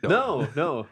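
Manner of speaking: usual speaking voice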